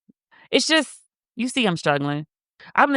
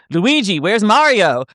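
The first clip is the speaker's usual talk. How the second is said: louder, higher mock shout; mock call-out; 4 semitones higher, 5 dB louder, slower than usual. People online wishing for him to die, loud taunting call.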